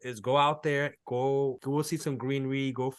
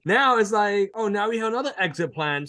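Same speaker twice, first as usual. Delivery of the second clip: excited disbelief; 8 semitones higher, 4 dB louder than usual. Retold or imitated. Excited retelling of getting listing.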